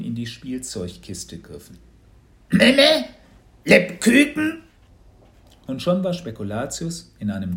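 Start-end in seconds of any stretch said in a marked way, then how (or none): 2.5-4.6 very high, loud dragon voice; little creature's squawk. A narrator's reading. Little dragon being grabbed, high squawking. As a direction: squeal like a small startled creature.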